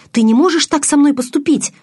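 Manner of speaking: высоко и громко, с мольбой; протестуй, умоляя; отчаянный протест. A narrator's reading. (с отчаянием)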